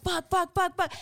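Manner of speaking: mock panic as cops arrive; panicked cursing. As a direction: mutter in rising panic.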